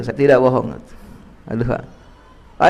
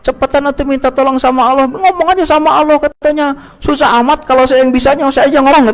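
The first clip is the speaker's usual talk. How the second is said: wife voice; 9 semitones higher than usual. Retold or imitated. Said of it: "Istri saya nyuruh saya".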